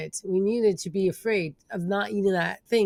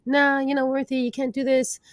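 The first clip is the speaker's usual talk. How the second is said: higher, mocking doubtful voice; inner critic voice; 6 semitones higher than usual. Speaker voicing her inner critic telling her she can't, higher mocking tone. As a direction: sneer it like the nagging inner critic. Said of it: "if I had inner critic thoughts like".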